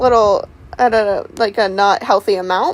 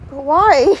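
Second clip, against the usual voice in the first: louder, pressing repeat of a question; insistent questioning; 3 semitones higher, 5 dB louder than usual. Real, not acted. Same question pressed again, louder.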